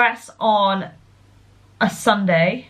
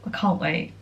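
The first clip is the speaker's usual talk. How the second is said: soft repeat; 4 semitones lower, 7 dB quieter than usual. Real, not acted. Repeats her excitement.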